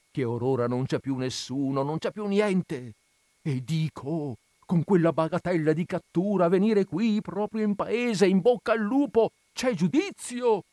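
put-on high, alarmed old curate, pressing; anxious old man scolding in disbelief; fretful old priest. A narrator's reading. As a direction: Don Abbondio frets at reckless Renzo.

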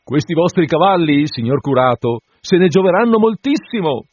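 voce più alta e forte, adulante; l'assistente adula il curato galoppando; assistente lusinghiero. A narrator's reading. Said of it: "diceva l'assistente nel galoppare". (assistente adulatore, voce alta)

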